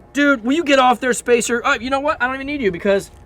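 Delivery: high-pitched, put-on annoyance; mock exasperation. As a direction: act fed up and brush them off. Acted irritation at spacer borrower.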